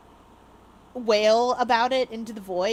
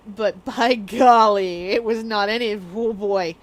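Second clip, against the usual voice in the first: drawn out; 6 dB louder, slower than usual. Acted. Dramatic emphasis.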